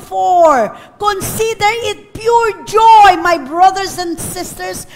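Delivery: slow, loud scripture reading; preaching. Real, not acted. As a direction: proclaim the verse to the church. Preaching scripture at full force. (mariing pangangaral)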